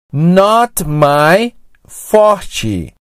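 very slow Not my forte, forte as FOR-chee; drawn-out Not my forte with Brazilian forte; slow Portuguese-like pronunciation. Shown as a demonstration. Phrase introduced very slowly, Portuguese-like.

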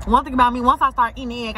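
usual speaking voice

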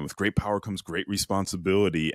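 normal talking voice

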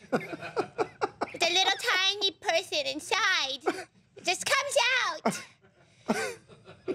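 high, slow tiny voice; little tiny voice. Shown as a demonstration. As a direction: squeak it out like a tiny person inside. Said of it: "that's my little tiny voice".

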